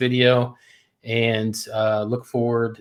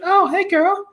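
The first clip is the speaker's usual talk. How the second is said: put-on much higher sing-song hey girl; gushing girlfriend greeting; 3 dB louder than usual. Wife imitating herself greeting a girlfriend, high sing-song. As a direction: coo it like greeting a bestie. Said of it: "she's like".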